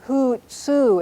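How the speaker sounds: hutsu with both U's drawn out; two long U's. Shown as a demonstration. Teacher gives the word for bird, both U's long in hutsu. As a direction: stretch each U in hutsu. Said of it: "It has two long U's in it".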